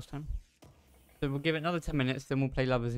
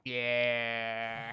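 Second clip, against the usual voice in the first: put-on grizzled old man voice; 3 semitones lower, 4 dB quieter than usual. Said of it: "an old grizzled old man voice".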